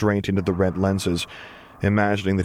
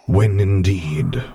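slow, drawn-out murmur from Vader; Vader's murmur; 5 dB louder, much slower than usual. A narrator's reading. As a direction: let it hang, slowly, to himself. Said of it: "Vader murmured quietly to himself".

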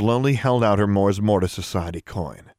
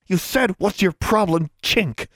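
higher, bitter hissed retort; hissed accusation; 10 semitones higher than usual. A narrator's reading. Anna quotes Tomo's insult back, hissing. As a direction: hiss the quote back at her. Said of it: "Anna hissed".

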